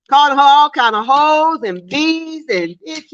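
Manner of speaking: ordinary talk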